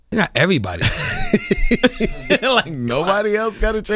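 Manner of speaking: high giggling talk; high laughing disbelief. Real, not acted. Laughing about chain snatching.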